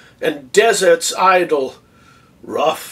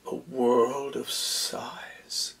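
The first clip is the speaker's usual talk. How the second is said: slow, very soft near-whisper; hushed wonder; 3 semitones lower, 12 dB quieter, much slower than usual. Acted. Othello recalls Desdemona's response to his story, slow and hushed. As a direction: draw it out softly, like a sigh. Dwells on her sighs in monologue.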